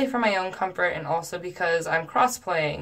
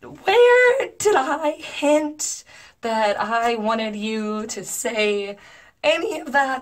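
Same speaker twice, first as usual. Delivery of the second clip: high, slow, incredulous protest; exasperated disbelief; 5 semitones higher, slower than usual. Retold or imitated. Answering his oversharing in her head, exasperated. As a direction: stretch each word in pained disbelief.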